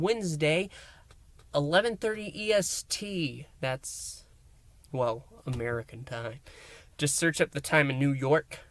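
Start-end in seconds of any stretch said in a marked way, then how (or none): none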